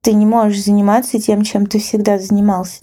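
ordinary talk

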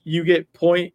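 ordinary delivery

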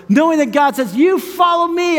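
high, ringing sermon delivery; impassioned preaching. Real, not acted. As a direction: drive each promise home to the congregation. Preaches God's call.

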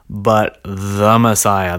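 "the" drawn out long and loud in "the Messiah"; stress on THE. Real, not acted. Setting the one Messiah apart from others, stress on "the". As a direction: lean slowly and hard on "the". Contrast with any old Messiah.

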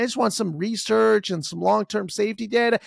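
normal talking voice

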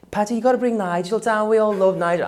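high, loud Scouse voice; Scouse accent of a pushy caller. Retold or imitated. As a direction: do a Liverpool accent, keen and pushy. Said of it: "that was a Scouse accent".

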